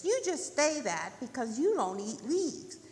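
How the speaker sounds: lightly voiced rabbit, accusing; retorting rabbit. Rabbit answering turtle about leaves, retorting. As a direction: argue back with a slight lift.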